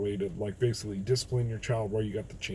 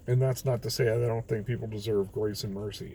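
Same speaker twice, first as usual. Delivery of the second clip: ordinary talk, nothing marked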